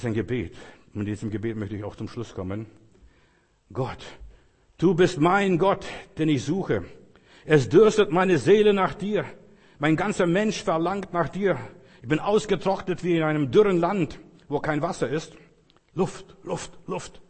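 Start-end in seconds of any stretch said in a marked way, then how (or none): 3.7-15.3 raised, declaimed prayer with stretched stresses; fervent psalm reading. Acted. Preacher reading a prayer psalm to close, fervent. 15.9-17.2 breath-only, whispered gasps; gasping for air. Acted. Preacher acting out thirst for God, breathless gasping. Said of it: "nach Luft geschnappt".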